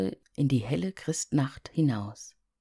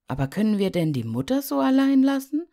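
put-on anxious boy; 10 semitones higher, 7 dB louder, faster than usual. Heinz sorgt sich um Mutter.

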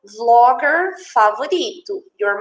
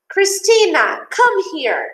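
higher-pitched, drawn-out call, mildly acted; calling from afar; 8 semitones higher, slower than usual. Mother calls Cristina from far off, mock calling out. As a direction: call out for a child, without real shouting. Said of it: "A mãe grita, né? Lá de longe".

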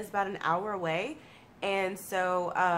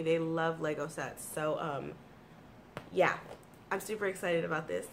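usual speaking voice

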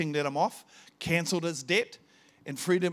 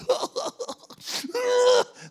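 sudden high wailing sob, retold; retold weeping wail; 8 dB louder than usual. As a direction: let out a mock crying wail. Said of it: "I started weeping like a big baby".

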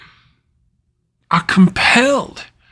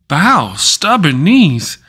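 ordinary talk

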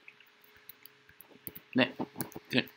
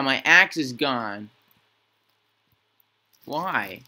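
ordinary talk

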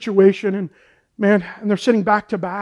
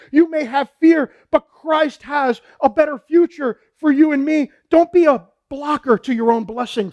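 raised, emphatic pulpit voice; impassioned preaching; 4 semitones higher than usual. Real, not acted. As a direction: preach it with fervor and drive. Preaches Jesus' call to listeners.

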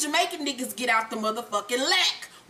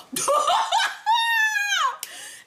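long, very high strangled squeal; mock choking squeal. Tommy started choking her, exaggerated strangled squeal. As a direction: squeal it out like someone being choked. Imitates Holly being choked.

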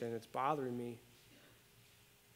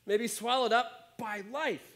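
measured, earnest reading of a Bible passage; scripture reading; 5 semitones higher than usual. Acted. Pastor reading scripture to the church, earnest and measured. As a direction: read the verses out to the congregation.

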